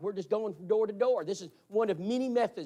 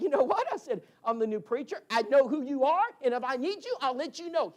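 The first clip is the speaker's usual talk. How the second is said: defiant retort; 7 semitones higher, 4 dB louder than usual. Retold or imitated. Preacher tells neighbour off.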